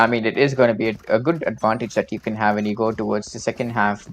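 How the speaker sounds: ordinary talk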